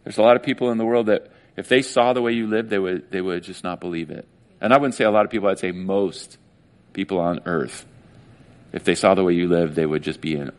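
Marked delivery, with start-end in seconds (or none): none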